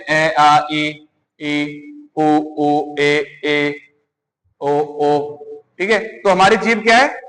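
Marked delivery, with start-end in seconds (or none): 0.0-5.4 ए, आ, ई, ई, उ, ऊ said one by one as clear open vowels; teacher sounds out each vowel for the class, drawn out and clear; vowel sounds demo. Shown as a demonstration. A teacher models the vowels for students, slow and clear. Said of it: "जैसे स्वर का हम उच्चारण करते हैं".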